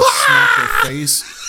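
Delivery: high, very slow shriek; shrieking exclamation. Retold or imitated. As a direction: squeal it out in disbelief. Recalling girl's fall.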